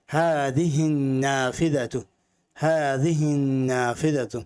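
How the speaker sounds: slow, precise "হাদিহিন নাফিদাতু" with a doubled n; a teacher modelling a phrase for the class to repeat; clear model reading. Shown as a demonstration. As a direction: articulate every syllable for students to copy. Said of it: "আমি যেভাবে উচ্চারণ করছি আপনারা চেষ্টা করবেন".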